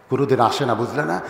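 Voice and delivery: ordinary delivery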